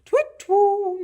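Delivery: high, loud, drawn-out hoot; owl hoot. Acted. Owl hoots in the story.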